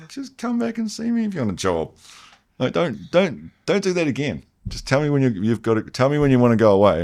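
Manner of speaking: a hint of a kindly boss telling him not to quit; imitating the boss. Boss reassuring Jed he can come back, lightly imitated.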